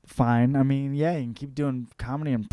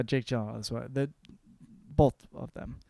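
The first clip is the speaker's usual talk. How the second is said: normal talking voice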